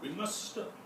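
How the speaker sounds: put-on a light British accent. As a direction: try a posh British accent. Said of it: "Who can do a British accent here?".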